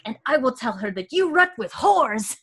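put-on high, threatening French-accented kid; French-accented boy. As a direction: play a little French boy making a threat. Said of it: "starts speaking English with such an accent".

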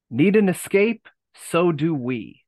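loud announcer-style tagline; catchphrase read. Shown as a demonstration. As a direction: punch it like an ad slogan. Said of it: "I'm gonna try to get it right this time".

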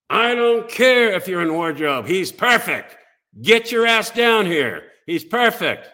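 a tough lady hollering down the hall; imitating a gruff boss shouting; gruff casting director. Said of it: "a gruff voice like this".

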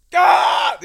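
loud, high-pitched scream; full scream. Retold or imitated. Man screaming alone on a drive home, full scream. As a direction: scream it out to dump emotion. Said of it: "and just scream as hard as I could".

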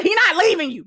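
high, loud exasperated retort; yelling at a TV character in exasperation. Retold or imitated. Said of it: "I'm like".

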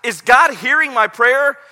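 doubt crying out to God; anguished questioning. Retold or imitated. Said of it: "it was this moment".

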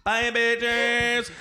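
hammed-up high indignation; outraged. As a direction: shriek it, then flounce off. Outraged reaction to the couple.

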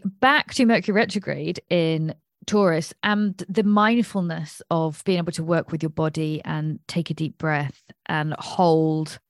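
normal talking voice

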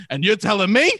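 rising, exaggerated "tell me about it"; mock-aggressive agreement. Bluffing through Nationals talk with a stranger, mock aggressive. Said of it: "the more you yell back at them".

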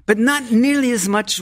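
high, quick, laughing through words. Real, not acted. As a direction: laugh it out as you speak. Laughs recalling the insult.

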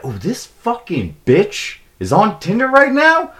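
high-pitched, drawn-out mock outrage; indignant outburst. Storyteller acts out spotting his girlfriend on Tinder, high indignant outburst. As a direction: squeal it in scandalized disbelief.

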